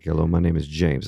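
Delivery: loud, deep announcer-like phone greeting; deep-voiced man. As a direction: imitate a man's really deep voice. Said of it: "He has this really deep voice".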